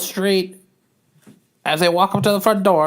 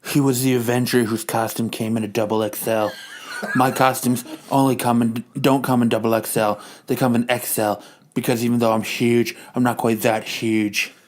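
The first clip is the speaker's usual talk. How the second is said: a hint of a big man's voice; lightly voiced low character; 9 semitones lower than usual. Character explains costume sizes.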